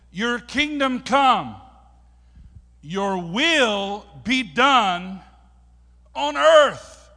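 very loud, slow, high proclamation; declaimed prayer. Acted. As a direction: stretch each phrase out at full force.

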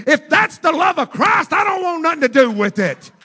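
loud, high shouted preaching; full-force preaching. Acted. Pastor exhorting the church to forgive, loud preaching.

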